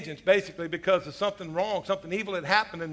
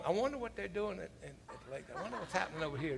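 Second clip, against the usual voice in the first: mumbled inner thought; 12 dB quieter, faster than usual. Retold or imitated. Distracted inner thought while watching.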